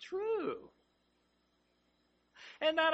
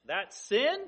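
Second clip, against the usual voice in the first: usual speaking voice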